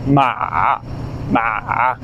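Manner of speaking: "Ma" low, soft, dipping down; third tone ma. Shown as a demonstration. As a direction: sink "Ma" low and quiet. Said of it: "the character that we're gonna say".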